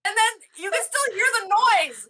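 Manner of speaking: high laughing frantic plea. Retold or imitated. Telling Carrie the noise still plays, high laughing plea. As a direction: laugh while pleading for help.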